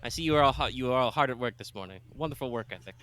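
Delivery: put-on lightly Italian-accented lord, full of praise; a touch of a courtly Italian host. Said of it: "trying very hard not to become Ezio Auditore".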